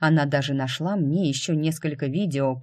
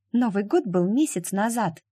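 повыше, детским голосом, недовольно; девочка недовольно бурчит отцу; буркнула; 6 semitones higher than usual. A narrator's reading. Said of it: "буркнула Полина". (детское бурчание)

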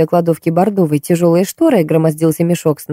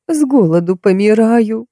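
put-on slow, whimpering old crone pleading hunger; whiny old woman; 4 semitones higher than usual. A narrator's reading. Old beggar woman whining to Marta about hunger, slow and tearful. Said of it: "плаксиво ответил надтреснутый старческий голос".

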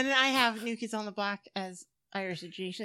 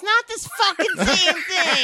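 loud, high, fed-up protest; raised voice objecting to a mix-up of nationalities; exasperated shout; 11 semitones higher, 11 dB louder, slower than usual. Real, not acted. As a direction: shout it down in disbelief. Heated argument over who counts Irish.

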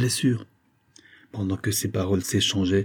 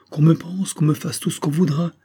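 high-pitched, insistent plea; acted stubborn protest, raised in pitch; desperate insistence; 8 semitones higher, 3 dB louder than usual. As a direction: plead urgently, pitch held well up. Wounded man refuses amputation, pleading.

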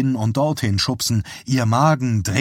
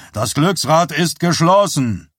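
higher, louder showman's call; insistent announcement; 5 semitones higher, 4 dB louder than usual. A narrator's reading. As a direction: call it out firmly to everyone. Showman repeats his announcement. (nachdrücklicher lauter Ausruf)

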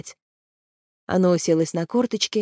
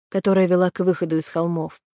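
usual speaking voice

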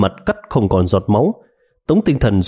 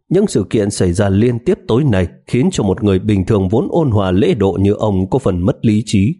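ordinary delivery

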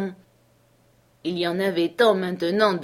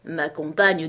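ordinary delivery, nothing marked

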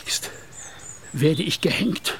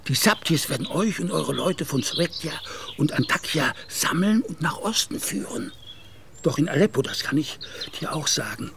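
ordinary talk

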